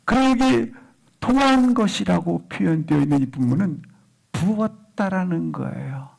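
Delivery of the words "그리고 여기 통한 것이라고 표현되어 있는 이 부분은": ordinary talk, nothing marked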